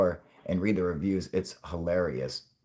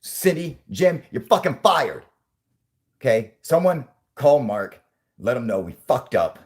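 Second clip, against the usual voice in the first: louder, slow, each word hammered; furious boss firing; 5 semitones higher, 6 dB louder than usual. Pretend. The boss firing Cindy and Jim, loud and slow. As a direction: fire them on the spot.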